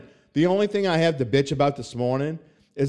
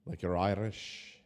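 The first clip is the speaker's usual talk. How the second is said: slow, lightly put-on Irish lilt; Irish accent; 9 semitones lower, 12 dB quieter than usual. Host asking listeners to talk football, in a slow mock Irish accent. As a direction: drag out a playful Irish brogue. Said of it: "talking about the New Orleans Saints".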